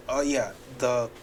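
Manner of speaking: ordinary delivery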